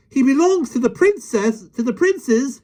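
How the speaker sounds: very high, loud shout. A narrator's reading. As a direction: shout over everyone. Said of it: "shouted Michael".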